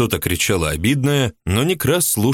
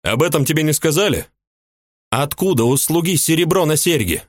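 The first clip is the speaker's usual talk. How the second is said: a much higher woman's voice, resentful and indignant; jealous housewife; 8 semitones higher than usual. A narrator's reading. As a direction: play the jealous mistress complaining. Ulyba voiced high.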